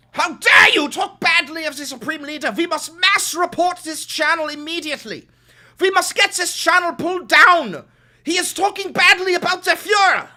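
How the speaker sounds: screaming zealot, high and loud; hammed-up outraged loyalist yelling; shrieking mock fascist; mock fanatic shout. Mocking a MAGA fanatic in chat.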